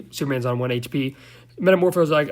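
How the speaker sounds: ordinary talk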